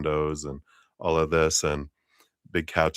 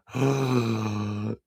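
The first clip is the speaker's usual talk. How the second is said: acted sharp gasp; shocked gasp; 4 semitones higher than usual. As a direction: gasp as if scandalized. Acting out a shocked reaction.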